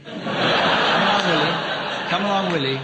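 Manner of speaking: coaxing. Acted. Coaxing a reluctant panellist.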